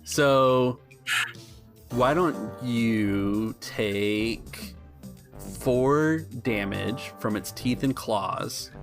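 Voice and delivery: usual speaking voice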